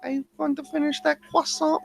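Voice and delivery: high, soft cartoon character voice; small, high-pitched cartoon voice; Carl Weezer. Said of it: "like Carl Weezer".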